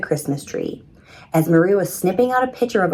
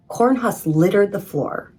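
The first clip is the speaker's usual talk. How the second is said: plain reading voice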